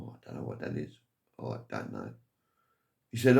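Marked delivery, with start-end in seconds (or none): 0.0-2.1 hushed unvoiced mock puzzlement; breathy stage whisper of not knowing. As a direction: whisper like someone stumped at a guessing game. Frenchman stumped at party game.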